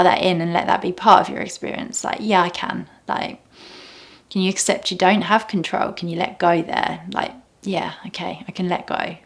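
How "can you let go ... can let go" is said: soft, breathy whisper of acceptance; a hushed whisper of surrender; whispered letting go. Pretend. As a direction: breathe the words out gently, almost silent. Quiet meditative self-talk.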